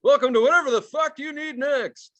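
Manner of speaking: high, loud host welcome; game show host. Acted. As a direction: announce it like a TV host. Announcing a mock show title.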